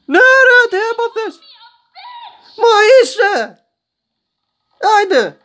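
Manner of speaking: shrill mock woman shriek. Gamer mocks a character in a high shrieking voice.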